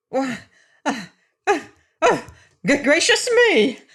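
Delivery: stammering, rising to a high loud alarmed cry; startled little man losing his nerve; cry of distress. Acted. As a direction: panic building to a squeal. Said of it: "he cried in distress".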